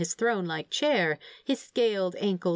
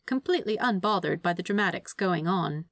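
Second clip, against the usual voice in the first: usual speaking voice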